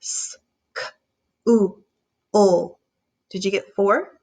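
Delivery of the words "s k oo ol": S whispered, then oo and ol said apart; sound by sound. Shown as a demonstration. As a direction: break school into separate sounds. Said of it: "Say it sound by sound".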